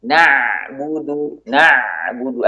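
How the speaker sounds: very slow "Na'budu", the ain flowing briefly; slight flow on ain. Shown as a demonstration. Teacher demonstrating the ain for students, slowly. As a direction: let the ain of "Na'budu" flow just a little. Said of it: "Ain juga demikian".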